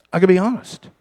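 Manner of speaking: ordinary talk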